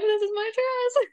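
very high, mock-tearful squeal; imitated happy crying. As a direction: imitate yourself crying over finding the dress. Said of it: "then I started crying".

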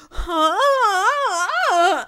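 high, breathy-sounding mock moan; mock gasp. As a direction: overplay her reaction. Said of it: "She's like".

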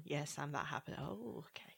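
near-whispered deadpan reply; hushed mock resignation. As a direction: drop to a hushed, resigned aside. Acted exchange of stunned viewers.

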